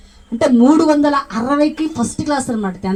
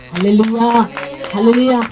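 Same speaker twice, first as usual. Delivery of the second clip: loud hallelujah call; 2 semitones lower, 4 dB louder than usual. Real, not acted. Preacher rousing the congregation with a loud call. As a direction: ring out the hallelujah to the church.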